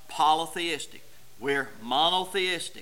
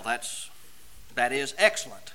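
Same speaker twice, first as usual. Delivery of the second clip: ordinary delivery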